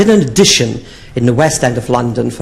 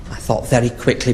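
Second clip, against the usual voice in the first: normal talking voice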